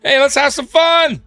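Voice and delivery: the bison egging them on, up high; voicing the bison. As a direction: bison voice teasing the tourists, playful and high. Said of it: "which tells me, like".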